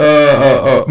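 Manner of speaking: ordinary talk, nothing marked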